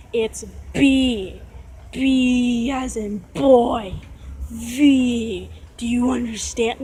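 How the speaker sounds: very slow mock spelling-out; exaggerated condescension. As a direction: drag out each letter as if to someone slow. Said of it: "it wasn't like this".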